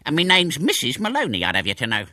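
put-on indignant old woman, starting high; cantankerous, high-then-dropping retort; snappish. A narrator's reading. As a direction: correct someone huffily. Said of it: "snapped the owner".

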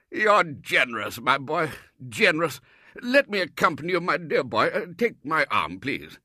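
overflowing with gratitude; effusive Colonel. Acted. Colonel praising Robert's generosity, loud and gushing.

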